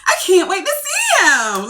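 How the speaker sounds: very high, drawn-out giddy gushing; giddy tone change. Acted. Showing how her tone changes for a date, giddy gush. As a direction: squeal it slowly through a laugh. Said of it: "Now I'm like".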